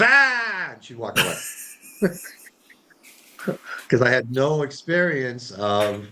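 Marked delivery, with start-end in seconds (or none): 0.0-0.7 high, drawn-out imitation scream; hammed-up "aah" of exasperation; mock exasperated scream. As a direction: mimic a wife's frustrated shriek. Imitates wife's exasperated yell.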